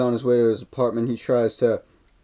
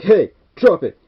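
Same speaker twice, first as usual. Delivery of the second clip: high, loud order to drop it; a kid voicing the hero's warning yell; mock shouted command; 7 semitones higher, 10 dB louder than usual. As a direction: bark it at the gunman.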